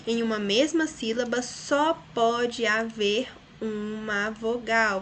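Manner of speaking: slow, with weight on só; slow emphatic repeat. Shown as a demonstration. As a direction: dictate it slowly for the class. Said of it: "vamos repetir aqui". (devagar, ditando com ênfase no só)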